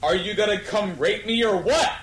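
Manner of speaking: ordinary talk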